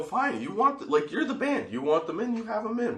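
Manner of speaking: high-pitched mock exasperation; retold fed-up surrender, voice pushed up; exasperated giving in. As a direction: throw up your hands and concede. Guard gives in to yelling man.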